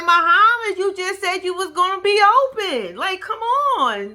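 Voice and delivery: loud, high protest; retold indignant urging; exasperated pleading. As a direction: chide him, stretching the final plea. Acts out scolding Muhammad.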